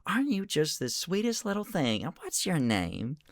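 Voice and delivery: high, tender coo; soft admiring voice; cooing at a pet. Acted. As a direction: fuss over the sweet creature. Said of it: "It's just like".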